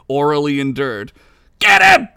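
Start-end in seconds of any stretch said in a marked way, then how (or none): 1.6-2.1 high, loud battle cry; rally the mob; pirate yelling. Acted. Pirate sending the crowd after the bard, loud yell. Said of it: "yelled the pirate".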